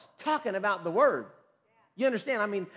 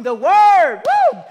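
exultant preaching shout; 12 semitones higher, 18 dB louder, much slower than usual. Real, not acted. Preacher celebrates the cleansing word to his congregation, exultant shout. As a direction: shout with joy, much higher and louder, stretching "word" into a whoop.

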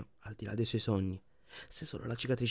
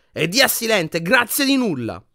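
bitter shouting; 10 semitones higher, 8 dB louder than usual. A narrator's reading. Harry shouting at the empty frame, bitterly furious. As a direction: yell it with furious sarcasm. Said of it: "sbraitò Harry".

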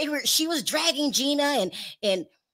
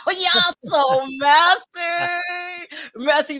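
drawn-out mock scold; 6 semitones higher, 4 dB louder, much slower than usual. Real, not acted. Gossip reaction, drawn out.

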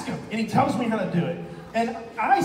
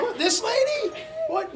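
high, amazed question; surprised; slower than usual. Real, not acted. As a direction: jump up in pitch, amazed. Excited host reacting to story.

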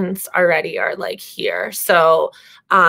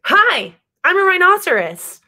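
higher, bright-eyed announcing voice; chirpy little dog; 8 semitones higher, 4 dB louder than usual. Acted. Lulu greets the other animals, pitched up. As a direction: proudly present herself, pitched up. Lulu introduces herself as rhino.

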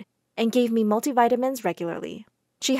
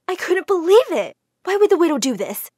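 shocked; 7 semitones higher, 5 dB louder than usual. A narrator's reading. Shocked narration.

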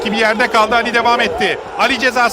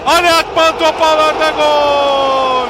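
rising goal shout; screaming football commentator; 3 dB louder, slower than usual. Real, not acted. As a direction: build to a yelled goal. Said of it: "earlier reader: screaming football commentator".

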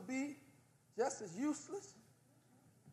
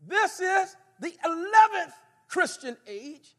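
loud, driving sermon delivery; preaching at full force; 10 semitones higher, 21 dB louder than usual. Acted. Preacher teaching the church at full force. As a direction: press the history on them with force.

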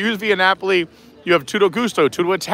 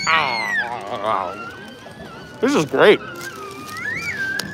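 talking around a mouthful of pretzel; mouth full; 5 dB quieter, much slower than usual. Real, not acted. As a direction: chew and talk, mouth full. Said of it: "talk with my mouth full".

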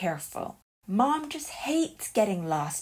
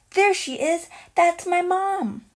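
louder, higher, eager cry; excited; 9 semitones higher, 5 dB louder than usual. Acted. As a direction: point and call out happily. Spots mom on TV screen.

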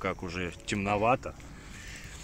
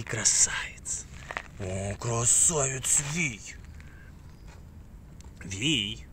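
soft whispered praise; 4 dB quieter, slower than usual. Real, not acted. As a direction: whisper it lovingly to the dog.

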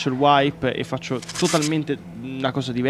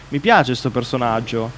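ordinary talk

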